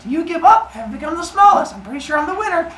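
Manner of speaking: high, loud squeaky gnat voice; mock-triumphant little squeal; tiny gnat. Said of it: "she's like".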